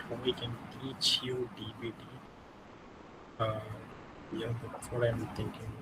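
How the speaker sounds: speaking very softly, hard to hear; too quiet for the mic; barely audible. Real, not acted. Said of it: "your voice is very, very low".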